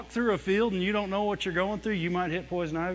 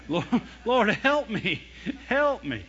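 higher, deliberate plea with spaces between cries; mock cry for help, trusting rather than frantic; crying in faith; 4 semitones higher, slower than usual. Shown as a demonstration. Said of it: "at least cry in faith".

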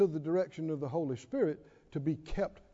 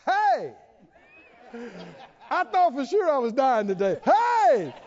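high, loud happy shouts; a survivor shouting with glee; joyful survivor; 6 semitones higher, 4 dB louder than usual. Acted. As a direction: whoop like you just escaped death. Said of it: "Then you go".

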